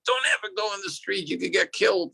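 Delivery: high, urgent parental scolding; shocking stern warning. Shown as a demonstration. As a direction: impress the danger on a child, without shouting. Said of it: "You wanna really impress upon the kid".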